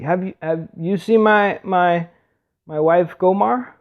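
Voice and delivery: high-pitched, halting plea for information; searching husband. Retold or imitated. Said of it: "And he's like".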